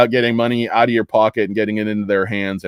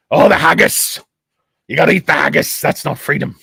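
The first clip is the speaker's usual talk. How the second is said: hammed-up Scottish outburst, pitched higher; mock Scottish; 5 semitones higher, 4 dB louder than usual. Streamer riffing as a Scot about haggis, mock Scottish.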